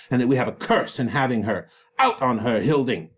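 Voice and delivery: loud, angry father tearing into his daughter; furious Capulet berating his disobedient daughter; raging father Capulet. Acted. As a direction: roar at her like an enraged father. Reads Capulet's raging speech.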